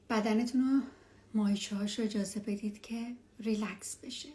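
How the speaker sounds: hushed and calm, guide them down; spa whisper. Acted. Leads a meditation.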